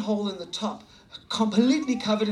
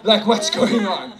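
panicked thought; 6 dB louder than usual. Retold or imitated. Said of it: "I was like".

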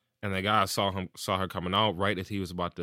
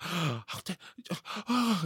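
much higher, cut-off sputter, caught off guard; flustered sputter; 11 semitones higher, slower than usual. Retold or imitated. Retelling the neighbor caught off guard, a high flustered sputter. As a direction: sputter in mock shock. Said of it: "she's, like, all flustered and shit".

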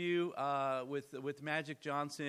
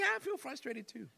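mock agreeing whine; 11 semitones higher than usual. Mock reply, agreeing frustration.

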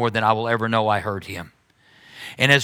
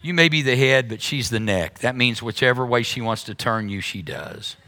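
usual speaking voice

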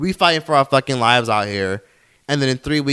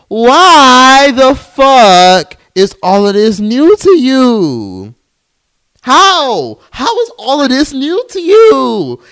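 high, loud, long-stretched exasperation; Amanda Seales; 10 semitones higher, 7 dB louder, much slower than usual. Retold or imitated. Podcaster to the newly shocked, exasperated impression. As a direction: wail each word slowly in incredulous outrage. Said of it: "In my damn Amanda Seales voice".

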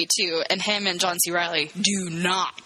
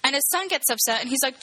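ordinary talk, nothing marked

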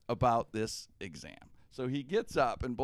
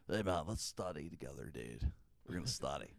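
lower, lazy stoner voice; burnout stoner buddy; 9 semitones lower, 7 dB quieter than usual. Retold or imitated. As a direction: play the burnt-out college roommate, lower. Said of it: "Bullwinkle is just always burnt out".

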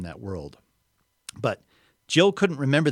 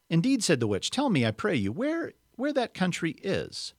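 witch voice; 8 semitones higher than usual. Acted. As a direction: coax like a sly witch.

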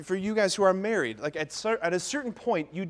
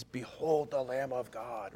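slow, low, softer liturgical intoning; hushed, slow Mass chant of an old priest; solemn old priest; 4 semitones lower, 7 dB quieter, slower than usual. Retold or imitated. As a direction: intone it like a grumpy old celebrant. Imitating old priest at elevation.

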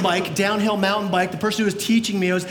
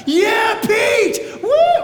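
high, loud whooping cheer from the boat; excited cheering; 11 semitones higher, 5 dB louder, much slower than usual. Pretend. Disciple in the boat cheering Peter on, loud excited whoops. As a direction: cheer your buddy on like a fan. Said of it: "I'm like one of the other disciples and I'm chickening out".

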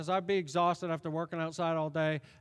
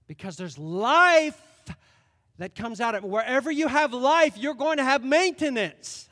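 preaching; 6 semitones higher, 8 dB louder than usual. Real, not acted. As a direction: build the point to the crowd. Preaching climax.

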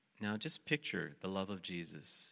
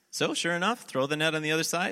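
ordinary talk

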